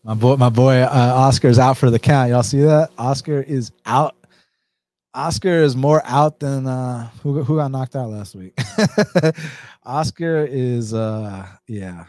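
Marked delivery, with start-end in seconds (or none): 0.0-7.6 excited. Real, not acted. Laughing at sleeping dog Oscar.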